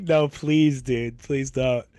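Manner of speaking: slowing, fading plea; dying-off "please don't"; mock plea. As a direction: beg him to leave, dragging it out. Pleading with the intruder.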